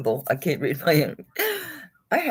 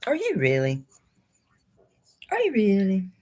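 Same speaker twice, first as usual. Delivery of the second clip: cooing; 4 semitones higher than usual. Pretend. Cooing to the pendant.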